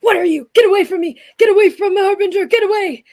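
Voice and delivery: high, loud chanted yelling; a terrified creature chanting at the top of his voice; frantic repeated shouting. Acted. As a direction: repeat it like a desperate mantra. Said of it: "he's just repeating in a mantra".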